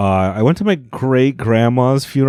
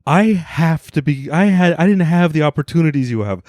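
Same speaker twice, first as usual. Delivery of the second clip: tearful counselor; 7 semitones higher than usual. Retold or imitated. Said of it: "break down in tears".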